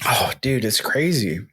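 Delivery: hushed whisper of awe. Real, not acted. Hunter telling his friend about the deer, whispered. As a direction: breathe it out in wonder.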